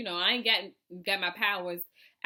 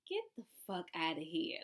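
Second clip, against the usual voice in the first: low, hushed retelling of disbelief; softly acted-out incredulity; disbelieving aside; 3 semitones lower, 7 dB quieter than usual. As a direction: say it under your breath, in disbelief. Acted disbelief at locked door.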